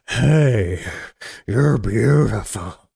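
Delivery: slow, drawn-out creepy come-on; creepy admirer. Acted. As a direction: play a creep sidling up to a stranger. Acts out the creepy shoulder stroker.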